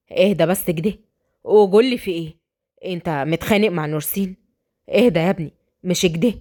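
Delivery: low male voice, steadying; concerned relative intervening; calming friend. Acted.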